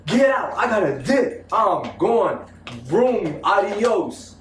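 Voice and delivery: high-pitched, hammed-up getaway; re-enacted urgency. Boyfriend telling girl he must flee, re-enacted urgency. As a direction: play the frantic exit for laughs. Acts out urgent escape from parents.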